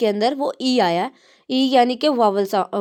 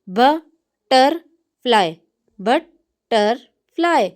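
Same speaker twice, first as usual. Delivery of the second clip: Butterfly drawn out very slowly, bat-ter-fly; slow syllables; much slower than usual. Shown as a demonstration. Teacher splitting a word into syllables for the class. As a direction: stretch butterfly into three slow beats. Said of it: "इसके अंदर तीन syllables हैं".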